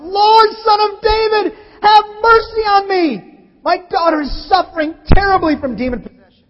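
loud, high desperate plea; crying out. Acted. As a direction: cry out for mercy, pleading. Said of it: "came to him crying out".

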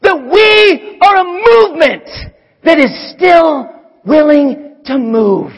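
rally the church at full force; preacher rousing the congregation with a quote, shouting. Real, not acted. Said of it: "what Mike Tolliver said".

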